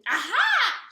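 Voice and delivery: high, loud triumphant shout; gleeful witch eureka yell. Acted. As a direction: shriek the aha with a jump. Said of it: "she shouted with a jump".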